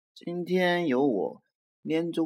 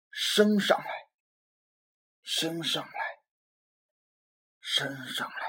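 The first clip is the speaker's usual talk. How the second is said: eerie fading echo; 3 semitones higher than usual. A narrator's reading. Anger rising inside Robert.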